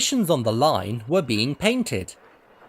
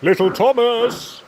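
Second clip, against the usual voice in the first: whistled; 10 semitones higher, 5 dB louder than usual. A narrator's reading. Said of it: "he whistled".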